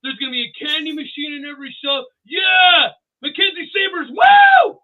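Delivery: high, loud hyped-up rally cheer; pep rally shouting. Retold or imitated. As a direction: shout it out like you're firing up a school crowd. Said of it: "it's like a pep rally".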